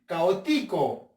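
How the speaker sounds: "caótico" wrongly stressed as ca-o-TÍ-co. Shown as a demonstration. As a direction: slowly push the "ti" in "caótico". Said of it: "Vamos a ver con la ti".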